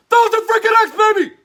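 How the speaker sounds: high, loud gleeful yell; hype-man victory whoop; triumphant shout. Acted. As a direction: crow it like you just won big. Shouted victory cry in pitch.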